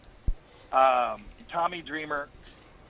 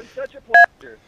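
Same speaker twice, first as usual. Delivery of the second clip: excited; 12 dB louder than usual. Real, not acted. Excited sign-off.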